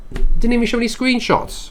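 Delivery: high, loud let-down; indignant that nothing's there; exasperated surprise. Real, not acted. Clicking an article with no screenshots, exasperated and surprised.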